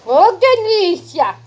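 loud, high, slow squeal of delight. Real, not acted. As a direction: gush over the puppy at the top of the voice. Squealing at puppy.

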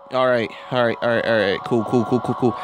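usual speaking voice

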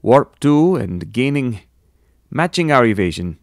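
hammy, poorly imitated Russian accent; Chekhov. A narrator's reading. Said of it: "imitation of a bad Russian accent".

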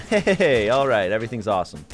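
ordinary talk

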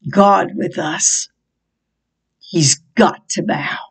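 hushed, breathy declaration; whisper. Real, not acted. Preacher whispering to congregation that the devil must bow. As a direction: whisper it with conviction.

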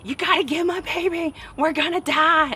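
high, loud, frantic pleading; a terrified mother begging for her baby; panicked mom. Retold or imitated. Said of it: "she was in shock, spazzing the fuck out".

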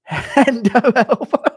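high, loud, slow words through laughter; helpless laughter. Real, not acted. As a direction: burst out laughing as you say it. Cracking up at chat's suggestions.

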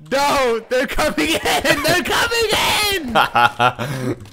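high panicked yell; panicked shouting. Real, not acted. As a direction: shout in alarm as they swarm in. Snakes attacking in game.

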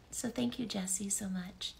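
soft unvoiced thanks; whispering. Real, not acted. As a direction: whisper it tenderly.

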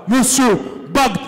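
long stretched-out name call; boxing ring announcer. Acted. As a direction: drag out every syllable for the crowd. Announcer calls fighter's name.

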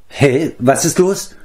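dazed waking voice; just woken up. A narrator's reading. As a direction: ask slowly, as if startled from a doze. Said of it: "als sei er eben erst aufgewacht".

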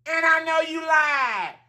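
high, slow, sassy shouting; playful sassy shout. Pretend. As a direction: call them out with mock outrage. Playful mock accusation, shouting.

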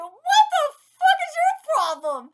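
high, loud outburst with laughter; incredulous, laughing high-pitched yell; exasperated shout. Real, not acted. Exclaiming at the character's behaviour.

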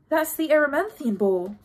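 usual speaking voice

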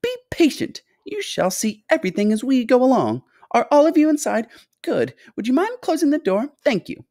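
high, piping tour guide; fussy high-pitched host, stretching key words; excitable fluty factory owner. A narrator's reading. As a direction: usher them along in a high, fluty voice. Story figure: fluty factory owner guiding.

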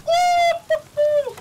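high whooping cheer; excited cheer. Retold or imitated. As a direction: whoop it out like a win. Retelling his excited cheer.

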